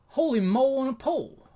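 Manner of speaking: high, loud hammed-up awe; over-the-top wowed outburst; amazed exclamation. God marvelling at the show.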